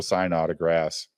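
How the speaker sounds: normal talking voice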